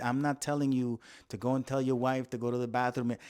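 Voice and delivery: ordinary talk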